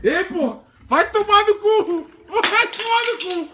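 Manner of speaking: high frightened yelling; scared horror game streamer. Real, not acted. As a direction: yell it out in panic, voice high.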